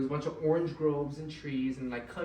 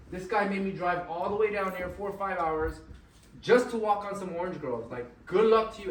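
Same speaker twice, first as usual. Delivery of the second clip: loud, higher retold griping; angry; 3 semitones higher, 6 dB louder than usual. Art fumes over his wasted drive down, angry. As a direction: build to a loud, exasperated send-off. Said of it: "He was angry he wasted his time".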